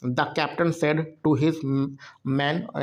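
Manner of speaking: slow, deliberate English reading; very slow classroom reading; slow dictation read. Shown as a demonstration. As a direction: read it word by word for learners. Teacher reads direct speech sentence.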